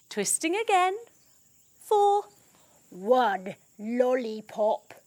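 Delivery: usual speaking voice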